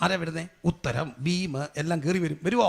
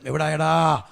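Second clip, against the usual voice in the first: louder, drawn-out retold call; insistent calling out; 8 dB louder, much slower than usual. Father phoning his late son, insistent loud call. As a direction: stretch the question out loudly, imitating a waiting father.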